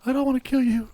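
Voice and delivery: high falsetto lady voice, a man in disguise; fake lady voice. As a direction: squeeze it up into a fake woman's voice. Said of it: "Disguise your voice like a lady?".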